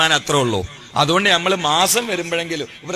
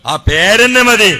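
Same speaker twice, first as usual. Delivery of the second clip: higher, louder, slow insistence; emphatic; 7 semitones higher, 11 dB louder, slower than usual. Real, not acted. Preacher to congregation, insisting the name is enough, slow emphatic. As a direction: press it home slowly.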